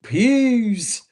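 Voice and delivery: high, loud, drawn-out peace; Martin's P M voice. Retold or imitated. Quitting the job for good in Martin's P M voice. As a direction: belt it like the sitcom sign-off. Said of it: "in my P M voice from Martin".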